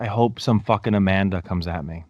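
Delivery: usual speaking voice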